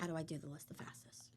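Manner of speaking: quiet, quick inner voice; whispered thought. Retold or imitated. Mother's racing thought about the caseworker's list, whispered.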